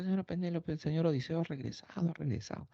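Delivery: hurried high call to the lady; excited maids. Retold or imitated. Maids announce Odysseus returned.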